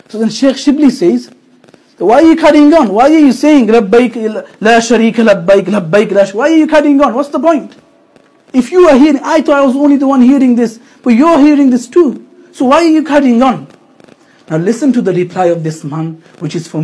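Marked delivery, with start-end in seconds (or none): 2.0-13.7 higher, louder pressing questions; insistent challenge. Retold or imitated. Shaykh asking the pilgrim why he carries on, insistent. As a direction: press him, voice raised in disbelief.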